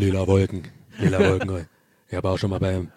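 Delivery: low, deep rapper impression; [name] voice. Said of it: "Hauptsache hier Materia ist mit dabei".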